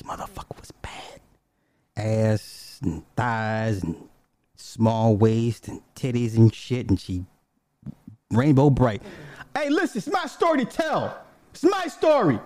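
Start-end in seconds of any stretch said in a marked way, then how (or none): 0.0-1.2 unvoiced awed whisper. Real, not acted. Telling the audience how fine she was, in an awed whisper. Hushed admiration of girlfriend. 9.5-12.4 much higher, mock-indignant insisting; playful protest. Real, not acted. Arguing with his wife off camera, high mock indignation.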